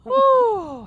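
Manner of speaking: big, loud, high "whew"; exaggerated whoosh of relief; acted sigh of relief. Jack learns whose body it is, hammed-up relieved sigh. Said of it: "the sigh of relief".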